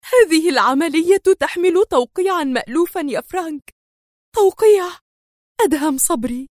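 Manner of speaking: high-pitched indignant statement; tense, resentful high voice; angry, trembling. A narrator's reading. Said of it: "في صوت مرتجف حانق".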